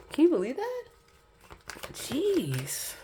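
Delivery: high put-on cartoon voice. Mocking the reading in a silly cartoon voice. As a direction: squeak it out in a silly character voice.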